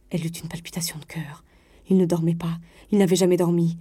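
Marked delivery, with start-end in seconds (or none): none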